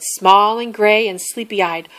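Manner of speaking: ordinary delivery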